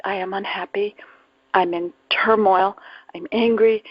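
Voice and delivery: ordinary talk, nothing marked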